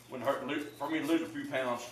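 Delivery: ordinary talk, nothing marked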